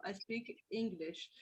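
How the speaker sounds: ordinary delivery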